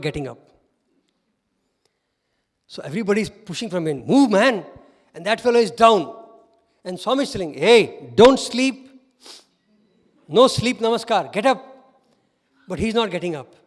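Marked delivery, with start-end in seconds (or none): 4.1-4.6 louder urgent shove in words; impatient call. Retold or imitated. Impatient boys pushing from behind. 7.5-11.6 loud, raised-pitch telling-off; mock scolding. Swami scolds boy bowed down.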